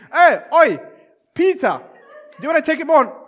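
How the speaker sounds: loud, high-pitched hail; acted shout across the ranks; calling out. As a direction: yell over to grab a mate's attention. Officer hailing another soldier.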